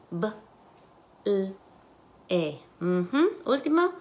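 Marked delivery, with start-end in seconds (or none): 0.1-2.6 "B, l, e" as isolated sounds with gaps. Shown as a demonstration. Teacher segments ble into phonemes, sound by sound. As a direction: sound out "B, l, e" one at a time. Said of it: "Las fonemas".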